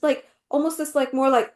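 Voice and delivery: usual speaking voice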